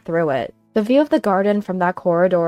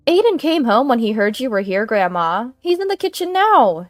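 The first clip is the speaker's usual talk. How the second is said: higher, bright lie; fake cheerful; 5 semitones higher, 4 dB louder than usual. Daughter-in-law lying about grandson, falsely cheerful higher.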